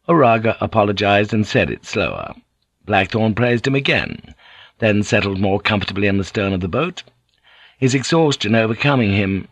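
usual speaking voice

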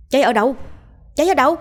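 high, loud clamour of alarmed villagers; nhao nhao hỏi. A narrator's reading. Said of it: "Họ nhao nhao lên". (nhao nhao hoảng hốt)

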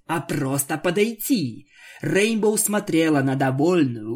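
plain reading voice

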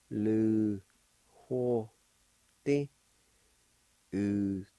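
"ho" with a faint breathy H; slow aspirated syllables; aspirated syllable drill. Shown as a demonstration. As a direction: sound out "U, do, lu, ho, ti" one by one. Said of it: "It'll sound like this".

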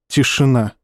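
ordinary delivery, nothing marked

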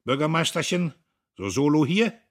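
loud, high, blustering taunt; big booming voice, pitched well up. A narrator's reading. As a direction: boom it out at full volume. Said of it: "dröhnend".

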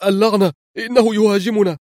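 high-pitched burst of alarm; panicked cry. A narrator's reading. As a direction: cry out as if under attack. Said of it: "فصرخ في ذعر". (صرخة ذعر)

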